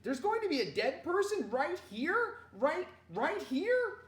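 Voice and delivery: high, loud, astonished questioning; fascinated child. A narrator's reading. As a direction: ask wide-eyed, slowing right down on the repeat. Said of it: "was listening and was fascinated".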